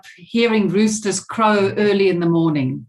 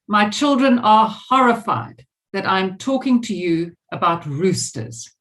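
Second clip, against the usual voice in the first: normal talking voice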